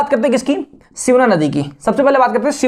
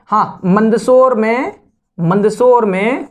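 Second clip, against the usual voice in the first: Mandsaur stretched out slowly and stressed; teacher hammering home Mandsaur; slow emphasis; much slower than usual. Shown as a demonstration. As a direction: drag out Mandsaur में so it sticks. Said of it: "कहाँ पर होगा भाई".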